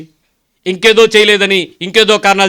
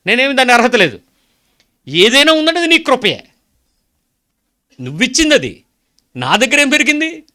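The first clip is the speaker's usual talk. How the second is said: high, raised pleading preacher voice; preaching with full fervour; fervent preaching prayer; 7 semitones higher than usual. Acted. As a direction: cry out to the Lord from the pulpit. Preacher addresses Lord in prayer.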